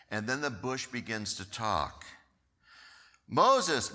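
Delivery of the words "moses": a higher, louder call across the field; divine call. Acted. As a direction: call his name from the burning bush. God calls from the bush.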